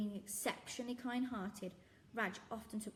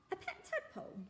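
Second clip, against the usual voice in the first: put-on surprised girl. Zoe surprised.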